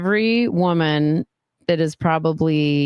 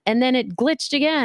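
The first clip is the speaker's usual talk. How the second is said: usual speaking voice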